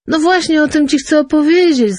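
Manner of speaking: chirping eagerly. A narrator's reading. Fisherman twitters to his angry wife, high and eager. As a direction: chirp it out to appease her. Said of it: "zaszczepiotał rybak".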